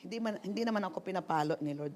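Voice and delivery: ordinary talk, nothing marked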